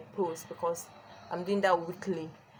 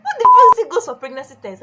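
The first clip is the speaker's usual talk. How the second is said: loud, higher indignant rant; much louder exasperated complaint; outraged; 8 semitones higher, 17 dB louder than usual. Real, not acted. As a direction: blast it out in disbelief. Outraged rant about test.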